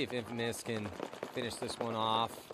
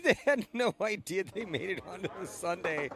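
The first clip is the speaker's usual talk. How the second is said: amused; 6 semitones higher than usual. Acted. Mock-amused reaction to team.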